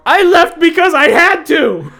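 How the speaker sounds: very high, drawn-out yell; desperate shout. Acted. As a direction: scream it slowly in self-defence. Acted quarrel, defends leaving.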